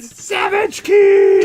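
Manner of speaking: high, loud, slow exclaim; loud excited call-out. Real, not acted. Friend reacting to the savage remark, loud and gleeful. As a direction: crow it like a burn landed.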